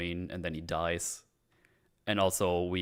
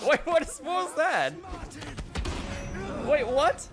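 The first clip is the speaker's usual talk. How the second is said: surprised. Real, not acted. Sudden glitch surprises player.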